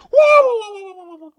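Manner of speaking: loud, high cartoon yelp while running off; Mario whoa yell. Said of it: "that's Mario running off".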